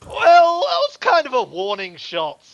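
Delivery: thug voice. Acted. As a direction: shrug off the shot. Thug answers player.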